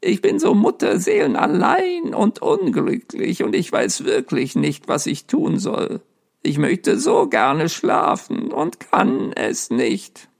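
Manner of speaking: lonely ghost. A narrator's reading. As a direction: pour out his misery, slowing down.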